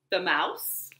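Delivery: ordinary delivery, nothing marked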